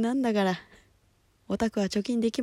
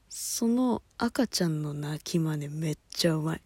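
ordinary talk, nothing marked

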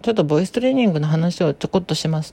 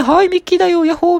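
very high, louder cartoon-mouse falsetto; Mickey falsetto; 12 semitones higher, 5 dB louder, faster than usual. Shown as a demonstration. Voice coach demonstrating an obvious falsetto, cartoon mouse style. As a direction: squeak it out like a cheerful cartoon mouse. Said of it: "裏声で".

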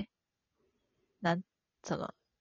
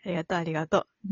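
quick repeated thanks; 4 semitones lower, 5 dB quieter, much faster than usual. Real, not acted.

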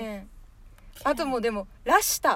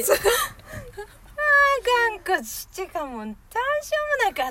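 auntie voice; 8 semitones higher than usual. Retold or imitated. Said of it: "おばちゃんたちの感じ。".

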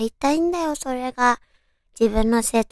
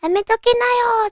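teasing warning; 12 semitones higher, 4 dB louder than usual. Retold or imitated. Imagined listeners' warning.

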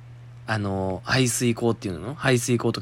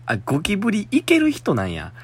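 high surprised realization; suddenly amazed she can handle them; 9 semitones higher than usual. Real, not acted. Host amazed the listener handles cockroaches.

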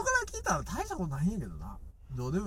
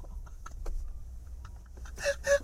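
helpless laughter; 4 dB quieter than usual. Real, not acted. Laughing at partner's embarrassment.

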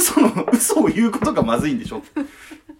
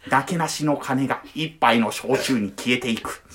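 documentary narrator voice; 5 semitones lower than usual. Retold or imitated. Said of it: "ナレーションの真似をします。".

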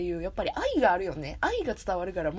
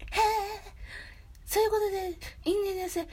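higher, airy put-on voice; Kakihara impression; 10 semitones higher, faster than usual. Host copying Sugita's Kakihara impression, higher. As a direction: float the lines up high in a borrowed voice. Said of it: "杉田さんがモノマネする柿原さん".

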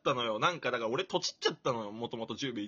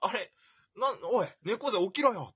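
higher, louder pretend telling-off; mock scolding wake-up; 7 semitones higher, 5 dB louder than usual. Acting out the wake-up call he never got, mock scolding. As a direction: rouse a sleeper with mock impatience. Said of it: "電話するとかせずに".

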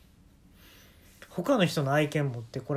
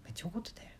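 めっちゃ怒ってたよ said much softer, almost under the breath; hushed repeat; 16 dB quieter, much faster than usual. Shown as a demonstration. Repeating the remark to oneself, hushed. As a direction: murmur めっちゃ怒ってたよ to yourself. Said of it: "いや、めっちゃ怒ってたよ。".

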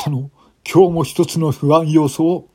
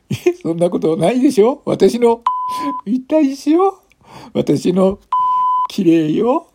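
tipsy coaxing, higher; dead drunk woman; 12 semitones higher, 4 dB louder than usual. Acted. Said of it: "一人の泥酔した女性が".

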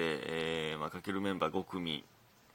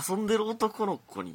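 higher, insinuating read of 遊んでる男の子に; meaningful emphasis; 11 semitones higher, 5 dB louder than usual. Retold or imitated. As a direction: read 遊んでる男の子に as if something is implied. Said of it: "含みのある言い方ですね".

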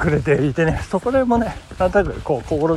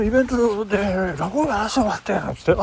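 usual speaking voice

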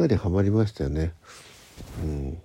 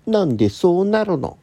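high, loud comic whine; comic catchphrase; 10 semitones higher, 8 dB louder than usual. Retold or imitated. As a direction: shout it like a TV comedian. Quoting comedian catchphrase.

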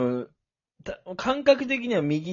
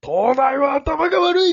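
ranter voice; 12 semitones higher, 4 dB louder, slower than usual. Retold or imitated. Imitating crazy train ranter.

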